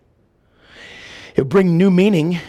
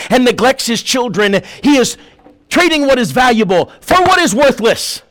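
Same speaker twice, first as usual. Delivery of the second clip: loud, high, driving sermon delivery; full-force preaching; 4 semitones higher, 3 dB louder than usual. Real, not acted. Preacher to congregation on neglected family, full-force preaching.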